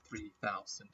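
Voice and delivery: low, soft trailing mutter; quiet. Real, not acted. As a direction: drop it under the breath. Same number, deflated aside.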